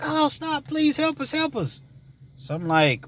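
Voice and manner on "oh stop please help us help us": put-on high, frantic little-girl pleading; imitating girls screaming. Girls running to the driver's car, high frantic pleading. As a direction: mimic a scared kid begging for help.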